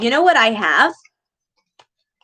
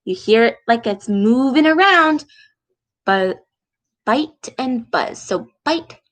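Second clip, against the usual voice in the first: usual speaking voice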